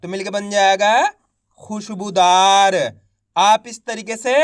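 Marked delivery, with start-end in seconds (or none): 1.6-2.8 खुशबूदार joined into one word, slow and drawn out; slow blended reading. Shown as a demonstration. Teacher blending the syllables for young readers, slow and clear. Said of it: "एक अक्षर का उच्चारण करके इनको पढ़ रहा हूँ".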